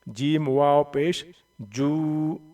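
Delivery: slow, stretched Quran reading; drawn out recitation. Shown as a demonstration. Teacher drawing out the vowel for students. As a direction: hold the long vowel out. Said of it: "थोड़ी सी खींच के पढ़ेंगे".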